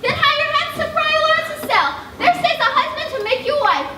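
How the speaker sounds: fast, high urging; hurried nurse. Acted. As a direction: rush her off to the friar. Nurse hurrying Juliet off.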